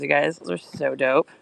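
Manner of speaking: normal talking voice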